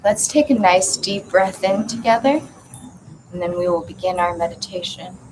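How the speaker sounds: measured meditation guide with long pauses; spacious, intoned visualization; guided meditation. Acted. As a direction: lead them gently through the imagery, phrase by phrase.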